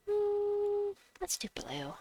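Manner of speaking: whispered sly decision; scheming whisper. Pretend. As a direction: hatch the plan in a whisper.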